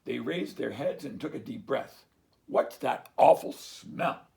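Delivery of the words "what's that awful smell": louder, slow cow grumble; grumbling cows. Acted. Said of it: "they grumbled".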